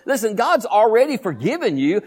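usual speaking voice

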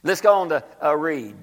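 usual speaking voice

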